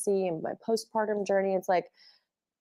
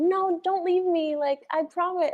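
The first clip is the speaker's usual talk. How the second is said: much higher, slow desperate begging; pleading ex; 10 semitones higher, slower than usual. Acted. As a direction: beg like someone being dumped, voice pitched way up. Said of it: "you're like".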